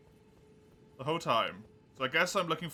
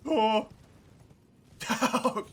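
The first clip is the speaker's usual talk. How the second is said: loud, high startled gasps; 9 semitones higher, 6 dB louder than usual. Real, not acted. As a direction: caught off guard by a scare. Jump scare in game.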